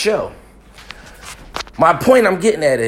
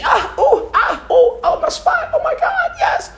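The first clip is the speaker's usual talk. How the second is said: very high, hammed-up moans broken by laughter; mock moaning woman; slower than usual. Mocking his date's moans during sex, very high and laughing. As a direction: squeal it like an overacted lover.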